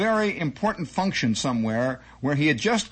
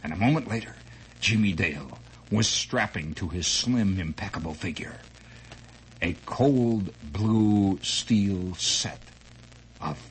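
low, hushed pulp-serial narration; hushed low storyteller voice; dramatic story narration; 8 semitones lower, 3 dB quieter than usual. Acted. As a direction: read it like a radio thriller, low and hushed.

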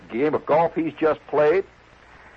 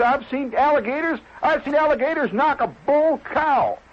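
high-pitched agitated tirade; worked-up warning; 11 semitones higher than usual. Acted. Club member tells guest how alligators kill, all worked up. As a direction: hold the pitch up, hammering home the danger.

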